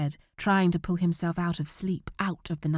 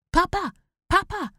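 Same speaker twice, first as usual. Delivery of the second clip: high, loud repeated call; urgent high shout; 14 semitones higher, 7 dB louder than usual. Acted. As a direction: call out to him across the street. Calling to his father at corner.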